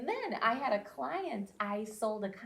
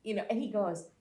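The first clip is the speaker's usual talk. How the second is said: usual speaking voice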